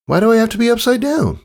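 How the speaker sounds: put-on curious kid blurting a question; young filly. Filly asking her father a quick, high question.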